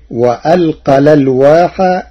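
الألواح said as lalwah, the hamza's vowel moved to the lam; hamza dropped. Shown as a demonstration. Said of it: "بنقل حركة الهمزة". (الألواح بنقل حركة الهمزة)

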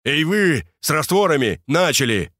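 stern command. Acted. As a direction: bark orders at the workers. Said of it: "распорядился грозно".

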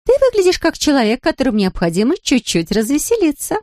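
high, lilting cooing woman; cheery Larisa. A narrator's reading. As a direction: say it high with swooping playful tune. Larisa's cheery line in fiction.

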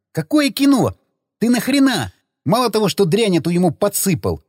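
high-pitched, incredulous reproach; agitated protest. Acted. Friend scolding the attacker over the spiked drink, agitated. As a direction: squeak it out in disbelief. Agitated quarrel after the blow.

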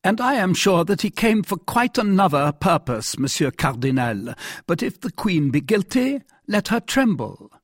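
high-pitched, insistent monarch; jealous king. Acted. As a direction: contradict the minister, building to a threat.

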